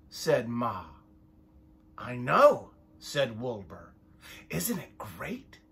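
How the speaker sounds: lively picture-book read-aloud, voices for each sheep; reading to children. A narrator's reading. Parent reading a sheep story to the child, lively storytime voice. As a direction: read it like storytime for little ones.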